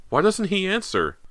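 high, loud alarmed question; frightened cry. Acted. Girl turning to her sister in fright, alarmed cry. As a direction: cry out with rising panic.

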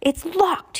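high alarmed cry. A narrator's reading. Mister Poe cries to the children that the gate is locked. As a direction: sound the alarm to the kids. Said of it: "he cried to the children".

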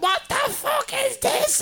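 very high, slow mock screech; freaking-out mother. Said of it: "freaking out".

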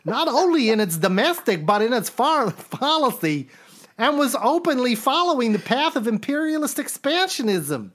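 the impression sliding into a nervous neurotic; Woody Allen-ish Pesci. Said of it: "that sounds more Woody Allen".

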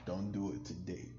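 put-on low-volume drunken mumble; soft, groggy mock sleep-talk; drunk sleep-talker. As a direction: imitate a passed-out drunk briefly stirring. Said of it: "pops up out of sleep talking".

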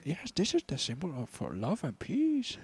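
soft, earnest mock Chinese accent; hushed caricatured Chinese accent. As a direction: explain it quietly in a put-on Chinese accent. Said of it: "we do such a racist Chinese guy".

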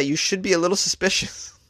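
ordinary delivery

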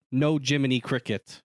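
ordinary talk

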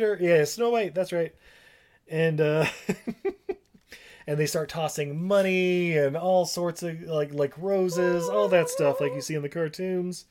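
usual speaking voice

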